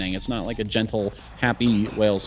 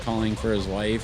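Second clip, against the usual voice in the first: ordinary delivery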